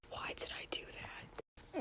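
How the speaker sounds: very soft voiceless whisper; mortified whisper to herself; whispered regret. Real, not acted. Embarrassed aside.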